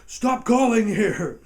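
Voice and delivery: loud, high and drawn out; slow mock yell. Teen yelling at the sheriff's operator down the phone, slow. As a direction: stretch each word into a fed-up shout.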